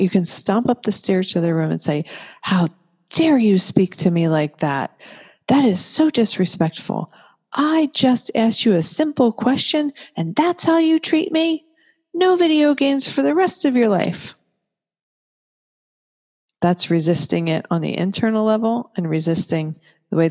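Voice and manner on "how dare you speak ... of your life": high, indignant parent scolding; hammed-up parental rant, much higher; furious scolding parent. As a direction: tell off the teen in outrage. Parent scolding rude teen.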